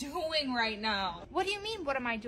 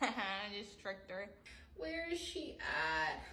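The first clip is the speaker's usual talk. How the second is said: whispering; 6 semitones lower, 11 dB quieter, much slower than usual. Acted. Hiding, sneaky.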